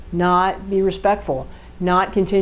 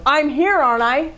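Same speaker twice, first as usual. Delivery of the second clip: higher, insistent husband; husband answering his wife, pitched up and insisting; defensive fact person; 8 semitones higher than usual. Acted. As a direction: insist you're here, pitched up. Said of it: "a fact-based person going".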